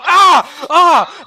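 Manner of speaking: high, loud yelps of pain; two loud cries from a stomped foot; acted pain yelp. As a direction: yelp sharply as if your foot got crushed. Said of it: "and you hear him go".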